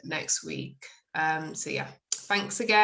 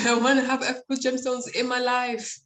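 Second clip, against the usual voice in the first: hammed-up begging, pitched up; mock pleading; 6 semitones higher than usual. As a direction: beg for help in mock desperation, breaking into a laugh. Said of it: "I'll be like".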